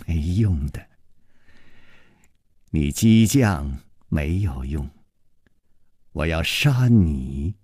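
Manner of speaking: chuckling, deep and unhurried menace; cold sneer. A narrator's reading. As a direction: dismiss the taunt with icy contempt. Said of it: "尹基冷笑着说".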